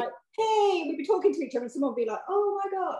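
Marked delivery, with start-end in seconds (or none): 0.4-0.8 high, drawn-out mock holler; talking really loudly. Runner retells angling for free drinks in a bar, loud high hey. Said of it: "talk really loudly". 2.3-3.0 high-pitched imitation of an American stranger; attempted American accent. Runner imitates an American in a bar, high mock American accent. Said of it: "I can't do your accent".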